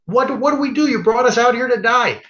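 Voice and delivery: high, loud panicked plea to God; panicked Moses. Retold or imitated. Moses panicking at the sea, a high, desperate plea. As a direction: cry out like a man trapped at the sea. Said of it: "Moses turns to God and says".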